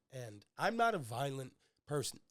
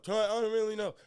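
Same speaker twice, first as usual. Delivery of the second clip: imitating bleeding uncle; 8 semitones higher, 6 dB louder than usual. Beaten uncle answers dad.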